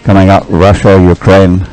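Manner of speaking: normal talking voice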